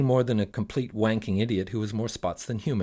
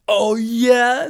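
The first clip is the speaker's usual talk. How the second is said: much higher, louder Oh, yeah?; louder genie retort; 12 semitones higher, 10 dB louder than usual. Acted.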